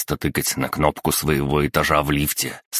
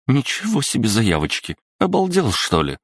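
higher, outraged mother, peaking on Обалдел; a mum taking offence, pitch shooting up; mother indignant at her son's question, much higher; 8 semitones higher than usual. Acted. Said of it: "возмутилась женщина".

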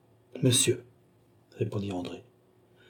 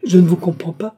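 high, loud defiant cry; first outburst of indignant denial; 7 semitones higher, 7 dB louder than usual. A narrator's reading. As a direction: fling it out high and loud, lifting at the end. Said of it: "de défi et presque de menace".

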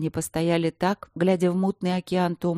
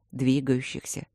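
plain reading voice

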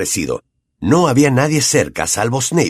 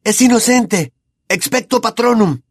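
high pleading spell; 11 semitones higher than usual. A narrator's reading. Harry pleading, casting.

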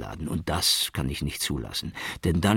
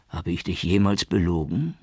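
ordinary delivery

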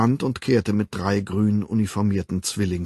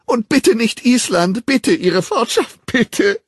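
hoch und laut, wimmernd bettelnd; verzweifelt flehend; 14 semitones higher, 9 dB louder, slower than usual. A narrator's reading. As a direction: auf Knien anflehen. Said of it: "verzweifelten Unterwerfungsgeste". (wimmerndes, verzweifeltes Flehen)

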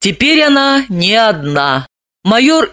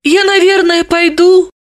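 high meek woman voice; 11 semitones higher than usual. A narrator's reading. Dalila meekly offers to leave.

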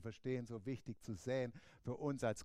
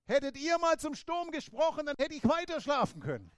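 higher, louder mock scolding; Jesus half-joking, fed up with being woken; exasperated Jesus; 10 semitones higher, 8 dB louder than usual. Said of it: "Deswegen hat er gesagt".

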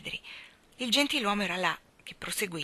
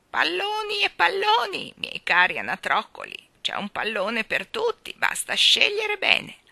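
voce alta e squillante da vecchietta allegra; vecchietta gioconda; 11 semitones higher, 7 dB louder than usual. A narrator's reading. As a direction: grida come una vecchia venditrice felice. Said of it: "gridò giocondamente la vecchietta". (gridato giocondamente da vecchietta)

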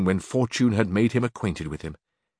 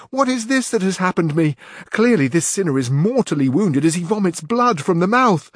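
high, louder panicked lament; alarmed squire; 9 semitones higher, 5 dB louder than usual. A narrator's reading. Squire fearing his wounded master bleeds, high loud alarm. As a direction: fear your master is dying. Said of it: "cried Sancho".

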